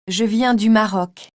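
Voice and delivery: ordinary talk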